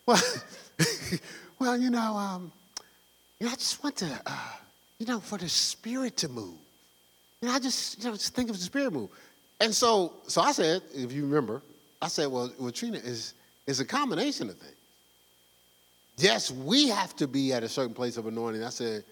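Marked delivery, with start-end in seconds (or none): 0.1-9.1 softer, halting put-on voice with a laugh; hesitant, dreamy church-lady voice; exaggerated for effect, softly trailing; mock Trina. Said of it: "just for effect". 12.3-18.6 low, soft explaining; calmly answer, much softer; a hint of gentle reasoning; quiet retold reply. Quoting his own past reply.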